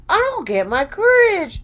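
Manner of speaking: high loud Lion voice; eager Lion. A narrator's reading. Lion telling friends his wish, high and eager. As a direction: proudly claim the courage. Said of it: "said the Lion".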